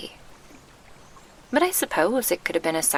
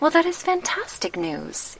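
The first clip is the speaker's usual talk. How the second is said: delighted; 12 semitones higher, 3 dB louder than usual. Acted. Hearing the council's good news.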